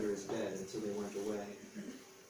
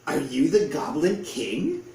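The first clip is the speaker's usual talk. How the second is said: slow, loud hopeful question; loud awed goblin question; eager question; 4 semitones higher, 7 dB louder, slower than usual. A narrator's reading. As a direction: ask it in awe. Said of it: "said the other goblins".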